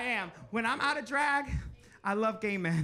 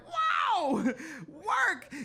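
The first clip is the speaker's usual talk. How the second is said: high squeal of surprise; a sudden high yelp of amazement; surprised delight; 9 semitones higher than usual. Retold or imitated. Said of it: "I was like".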